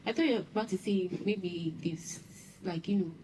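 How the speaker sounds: hushed gossip whisper; sharing a rumour with her best friend, whispered. Acted. Gossiping in secret.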